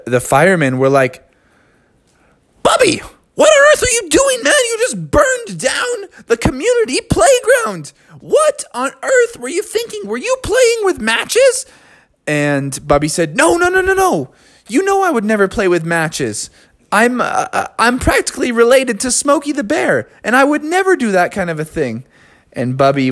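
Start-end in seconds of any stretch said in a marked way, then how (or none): 2.6-11.6 very high, fast, shouting scold; scolding fireman dog. Acted. 13.4-22.0 high, defensive protest; protesting bear. Acted.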